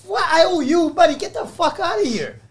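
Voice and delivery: high, loud retold telling-off; mock angry. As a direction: replay the scolding with a laughing edge. Retold confrontation with workers.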